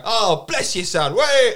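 mock London accent. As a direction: overplay the Londoner again, rising at the end. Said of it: "And I go".

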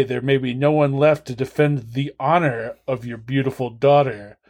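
ordinary talk, nothing marked

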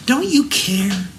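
high, slow wounded cry; hurt plea. Retold or imitated. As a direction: stretch out "Don't you care?" like a hurt, scared plea. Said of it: "they doubted his love".

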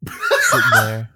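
high laughing yell, slow; laughing shout. Real, not acted. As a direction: burst out laughing mid-line. Dreamy story breaks into laughing shout.

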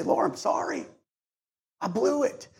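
hushed, breathy confession to God; whispered prayer. Retold or imitated. Pastor voicing a believer's confession, hushed whisper. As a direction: whisper it like a private apology to the Lord.

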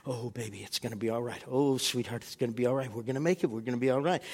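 mother patting her son's knee, soothing him softly. Retold or imitated. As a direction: comfort a frightened son gently. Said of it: "starts patting his knee".

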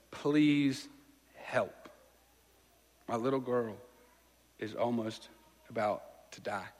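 a father pleading for his dying daughter; desperate plea. Retold or imitated. Desperate father begging Jesus.

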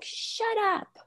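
high-pitched, softly voiced mock "shut up"; retold defiant outburst. Frustrated son cutting mom off, mock defiance. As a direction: imitate a kid's high, defiant retort. Retold child snapping back.

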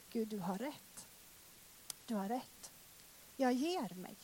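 quiet surrender. Retold or imitated. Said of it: "jag sa så här".

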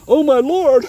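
high, loud panicked cry; panicked servant. Retold or imitated. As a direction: cry out in terror like the frightened servant. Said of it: "And he runs back and he says to Elisha".

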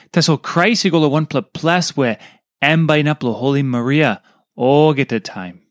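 usual speaking voice

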